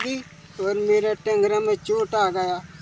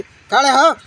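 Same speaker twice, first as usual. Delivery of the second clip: a loud barked order to stand up; retold command shout; 4 semitones higher, 9 dB louder than usual. Victim retelling the policeman ordering him up, loud barked command. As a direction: yell the order like an angry cop.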